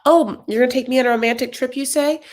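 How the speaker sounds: high mock-incredulous voice; mocking Kalani. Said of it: "She's like".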